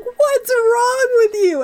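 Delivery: high, slow, pleading mock outrage; hammed-up what's wrong with you; exasperated Scott. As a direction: stretch it out, exasperated. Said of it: "Scott comes in and he's like".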